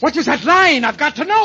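frantic high shout. Acted. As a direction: demand it at the top of your voice. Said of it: "please stop shouting".

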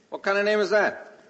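normal talking voice

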